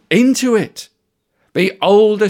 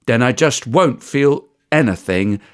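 resolute Sadie, low; 7 semitones lower than usual. Acted. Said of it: "said Sadie".

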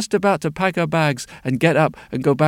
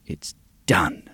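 whisper; 11 semitones lower, 3 dB quieter than usual. A narrator's reading. Potion finished, hushed narration.

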